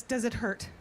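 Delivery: ordinary delivery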